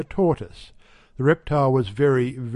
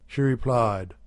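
usual speaking voice

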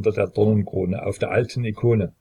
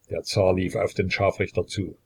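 ordinary delivery

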